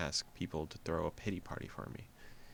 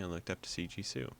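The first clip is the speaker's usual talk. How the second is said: normal talking voice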